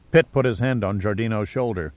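usual speaking voice

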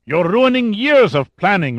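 loud, high-pitched accusation; angry. A narrator's reading. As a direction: accuse him in rising outrage. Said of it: "Lugovoy said angrily".

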